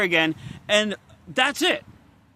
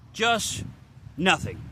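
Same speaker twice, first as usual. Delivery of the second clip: usual speaking voice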